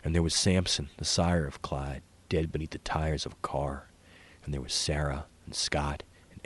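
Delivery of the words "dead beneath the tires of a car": ordinary delivery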